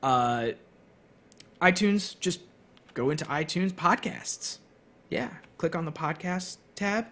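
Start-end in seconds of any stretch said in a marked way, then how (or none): none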